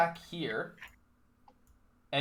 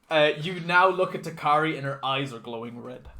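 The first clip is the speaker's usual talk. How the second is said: ordinary talk, nothing marked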